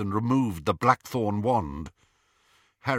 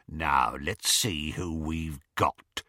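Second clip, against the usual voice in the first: slow, lower, gloating rasp; 4 semitones lower, slower than usual. A narrator's reading. Werewolf inspecting his captives, gloating. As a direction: take your time and gloat. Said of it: "said Greyback's gloating voice".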